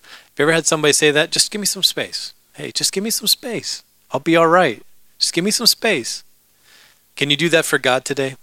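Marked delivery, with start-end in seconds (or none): 1.3-6.2 high, softened put-on voice; imitated upset person brushing others away; pleading for space. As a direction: beg off lightly in a high voice. Imitating someone asking for space.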